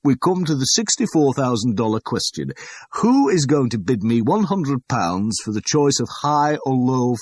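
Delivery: loud, high, grandly pitched sales patter; showy auctioneer. A narrator's reading. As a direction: work the room like a salesman. Auctioneer holds the room.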